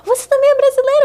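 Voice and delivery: very high, loud astonished question; retold amazement. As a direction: act out discovering a fellow Brazilian. Retold surprised discovery of compatriot.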